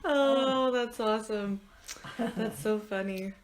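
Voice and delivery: usual speaking voice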